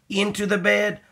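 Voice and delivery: ordinary delivery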